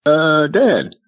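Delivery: lightly voiced hesitant young boy; a kid tentatively getting Dad's attention; little brother. Retelling little brother hesitantly alerting Dad.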